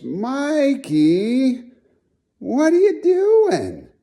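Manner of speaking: high, drawn-out mock reproach; mock disapproving friend. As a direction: stretch it out high, imitating a nosy friend. Friend questioning him at barbecue.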